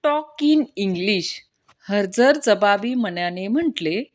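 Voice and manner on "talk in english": slow, drawn-out order, barely raised; mild teacher's yell. Acted. English teacher correcting a late pupil, mild drawn-out yell. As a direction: lightly act a scolding English teacher. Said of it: "sir पुन्हा ओरडले".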